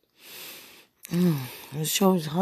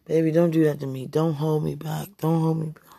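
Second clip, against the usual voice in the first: ordinary delivery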